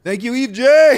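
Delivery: high, loud hammed-up thanks to the heavens; a hammed-up thank you to his savior; mock grateful prayer. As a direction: overplay the gratitude like a sudden convert. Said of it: "I go".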